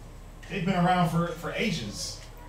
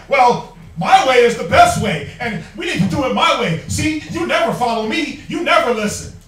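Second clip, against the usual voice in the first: mock shouting wife; 3 semitones higher, 8 dB louder than usual. Preacher mimicking a wife yelling at her husband, loud and laughing. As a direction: shout it like a stubborn wife who won't yield. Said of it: "and you're like".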